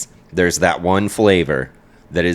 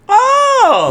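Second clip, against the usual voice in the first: high loud delighted squeal; 7 dB louder than usual. Pretend. Acting delighted reaction.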